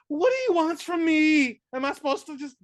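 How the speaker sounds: put-on horrible New Jersey accent. Actress in a talkie complaining in a New Jersey accent. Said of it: "I don't even think I'm doing a proper horrible New Jersey accent".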